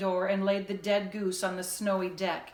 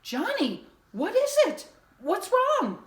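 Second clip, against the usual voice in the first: high, loud startled questions; jolted awake, demanding; frightened father calling out; alarmed father; 13 semitones higher, 6 dB louder than usual. A narrator's reading.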